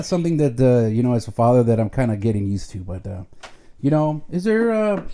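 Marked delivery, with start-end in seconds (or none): none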